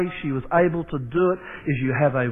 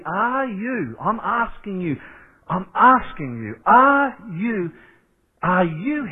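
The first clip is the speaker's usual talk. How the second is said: rising, insistent questions that climb higher; pleading emphasis; 6 semitones higher than usual. Acted. Pastor pleading with his church, insistent and building. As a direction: press each question home.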